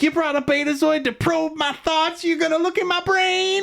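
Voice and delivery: high, squeaky paranoid official voice; Groppler Zorn impersonation. Retold or imitated. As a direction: pitch way up as a suspicious little bureaucrat. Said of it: "Groppler Zorn's like".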